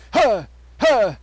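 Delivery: loud, high punched "huh"; imitated signature grunt. Host mimics his performer friend, imitated grunts. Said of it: "He does that, doesn't he?".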